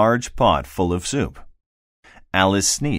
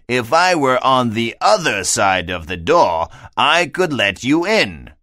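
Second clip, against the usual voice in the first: a higher, slowing fish voice, lightly done; a fussy fish explaining from the doorstep; 4 semitones higher, 5 dB louder than usual. A narrator's reading. The fish explains to Alice, higher pitched and measured.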